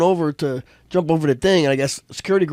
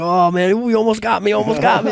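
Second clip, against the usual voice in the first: retold relieved exclamation; 7 semitones higher, 4 dB louder than usual. Guy laughing off the near punch.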